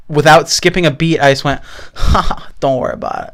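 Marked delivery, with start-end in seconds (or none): 2.0-3.3 put-on soft, whispery laugh into accented catchphrase; Tommy Wiseau. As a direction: let the impression slip out, hushed. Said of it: "my Tommy Wiseau voice, creeped in".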